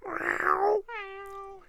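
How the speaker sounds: high thin meowing cat; cat's meow in the night, high and thin. Acted. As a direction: mew like a small cat far off.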